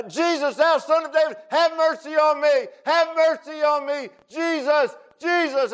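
high, loud repeated cries; desperate shouting. Acted. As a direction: shout for mercy at the top of your lungs. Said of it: "And he's shouting out".

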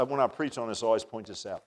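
ordinary delivery

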